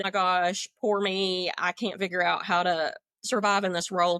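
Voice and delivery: ordinary talk, nothing marked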